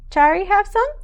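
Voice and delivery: put-on high, loud toddler asking; little sister voice. A narrator's reading. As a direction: beg like a tiny toddler. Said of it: "says Kamara".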